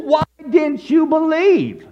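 high, slow, drawn-out reproach; exasperated rebuke. Acted. Jesus questioning Peter's doubt, exasperated rebuke.

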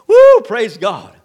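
very loud, slow shouted woo; joyful shouted praise. Real, not acted. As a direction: cry out in praise.

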